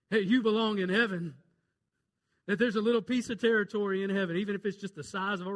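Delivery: loud, fast preacher's cry; fervent proclamation. Acted. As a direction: call it out to the church. Preacher exclaims.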